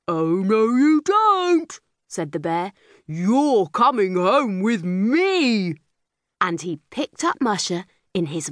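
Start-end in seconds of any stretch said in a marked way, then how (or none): 0.1-5.7 slow, heavy bear voice; bossy bear. A narrator's reading. Big bear tells captured girl to work for him. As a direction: growl orders at the little girl.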